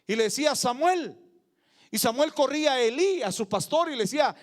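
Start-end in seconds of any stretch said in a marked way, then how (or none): 0.5-1.2 raised, louder call of a name. Acted. Preacher as God calling the sleeping boy Samuel, calling out. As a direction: call to a sleeper, mildly projected. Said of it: "Dios lo llamaba".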